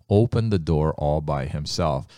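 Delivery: ordinary delivery, nothing marked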